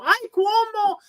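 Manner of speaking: high, gushing "Ay, Cuomo"; delighted old lady. Retold or imitated. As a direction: squeal the name way up high like a charmed abuela. Old lady recognizing the name.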